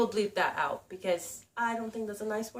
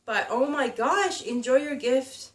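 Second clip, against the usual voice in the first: ordinary talk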